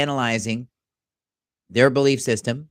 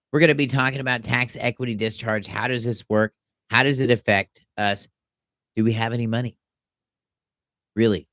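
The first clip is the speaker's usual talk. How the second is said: usual speaking voice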